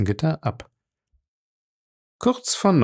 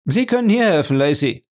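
louder, much higher call across the room; 6 semitones higher, 5 dB louder than usual. A narrator's reading. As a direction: call Lacey over. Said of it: "rief Jim".

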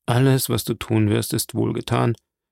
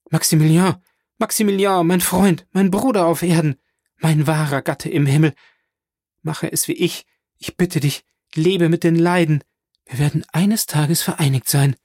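raised, higher-pitched impassioned plea; anguished cry; 6 semitones higher, 4 dB louder than usual. A narrator's reading. Valentine begs her beloved to live, anguished cry. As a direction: call out from a breaking heart, pitched up. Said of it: "ihr brechendes Herz zusammenpressend, auf die Knie und rief".

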